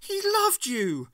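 very high, loud anguished cry; acted outraged, grief-struck shout. A narrator's reading. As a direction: wail the accusation, drawn out. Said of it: "cried Ace".

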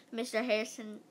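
ordinary delivery

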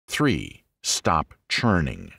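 slowed down, leaning on churning; slow emphatic heading. Acted. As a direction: let the point land, slowly. Sermon point heading.

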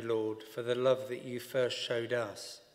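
normal talking voice

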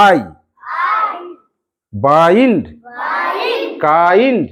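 আই, then বাইন্ড, কাইন্ড with the glide stretched; slow models of আই, বাইন্ড, কাইন্ড to echo; long আই sound. Shown as a demonstration. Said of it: "উচ্চারণটা কেমন হচ্ছে?".

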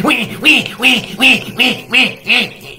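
put-on high, loud baby wail; squealing infant imitation. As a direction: imitate a baby crying out wee wee. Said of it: "when a baby is".

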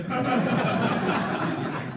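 a loud, high burst of laughing; real laughter bursting out. Real, not acted. Laughter after the quip.

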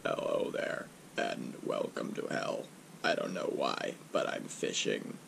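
very deep, quiet demonic rumble; low voice from the bottom of hell. Acted. Demon welcomes viewer to hell.

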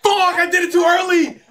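high, loud yell at a mistake; frustrated yell. Real, not acted. Frustrated gamer yells.

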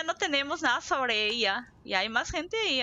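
usual speaking voice

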